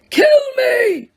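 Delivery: high, loud yell; old man yelling in torment; yelled plea. Acted. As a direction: yell it out in agony. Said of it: "he yelled".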